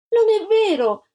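put-on high, loud child's cry of denial; shouting protest. A narrator's reading. Little girl shouting back at the lying blonde girl. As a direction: yell it, outraged at the lie. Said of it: "gridò Antonella".

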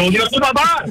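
Junior, tu papá called out high and loud. Shown as a demonstration. Host asks her to say it loud, so she calls it out. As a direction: belt it out for the room. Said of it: "dígalo duro".

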